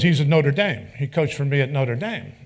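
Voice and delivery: emphatic imitation. Holtz tells Mike where Urban coaches, loud and proud. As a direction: sell it like big news.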